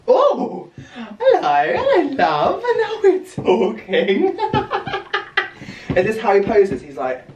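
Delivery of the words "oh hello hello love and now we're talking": greeting herself in the mirror like a crush; laughing flirty greeting. Real, not acted. Posing in the new trousers, laughing and flirting.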